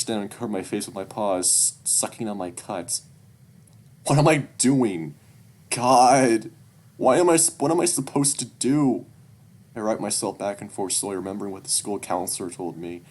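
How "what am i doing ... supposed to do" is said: loud, higher-pitched desperation; distraught outburst. Acted. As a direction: cry out at a loss, stumbling on the words. Distraught narrator berates himself.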